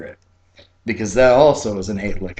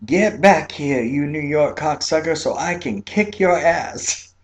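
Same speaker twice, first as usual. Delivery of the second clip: retold angry yell; 6 semitones higher than usual. Old fisherwoman yelling after a speeding New York driver, high mock yell. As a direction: bellow after the driver, slowing at the end. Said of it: "What's Zeppy yelling about?".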